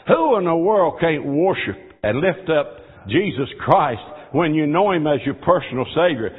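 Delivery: ordinary talk, nothing marked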